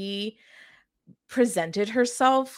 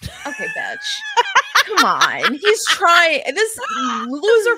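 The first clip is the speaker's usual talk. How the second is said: high laughing shout, then loud; fake-offended cackle at your friend; laughing mock outrage; 13 semitones higher, 8 dB louder than usual. Real, not acted. Cohost teasing her friend over a snarky line, laughing shout.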